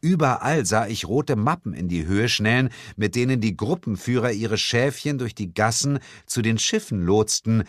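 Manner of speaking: usual speaking voice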